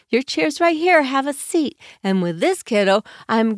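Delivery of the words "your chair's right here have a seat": higher, kind guiding voice; gentle direction. Shown as a demonstration. Parent holding a child's hand, gently higher. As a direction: lead the child to a seat kindly. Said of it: "I'm gonna hold their hand and I'm gonna say".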